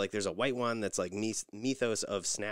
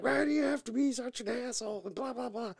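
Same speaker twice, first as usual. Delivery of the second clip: much higher mock-indignant question; put-on outrage at the husband; mock mad Nikki. Wife scolds the husband, mock-high and indignant. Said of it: "She gets all mad".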